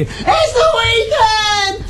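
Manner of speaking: long, high-pitched yell; shouting. Shown as a demonstration. Host shouts it for a shy caller, drawn-out shout. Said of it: "please will you shout ... I'll do it for you".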